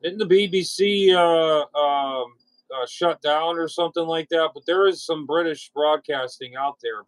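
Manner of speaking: ordinary talk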